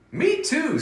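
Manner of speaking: high mouse chirp; a tiny mouse eagerly agreeing; little mouse voice. Acted.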